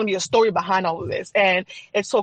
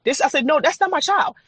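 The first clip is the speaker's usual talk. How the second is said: higher-pitched, insistent refusal; retold denial; 7 semitones higher than usual. New mother refusing what nurses say, in denial. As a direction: protest it as if refusing to believe.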